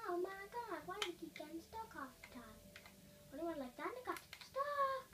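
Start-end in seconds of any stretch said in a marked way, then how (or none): 3.9-5.1 high-pitched exclamation. Real, not acted. Player finding they got stuck, high-pitched exclamation. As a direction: voice leaping very high.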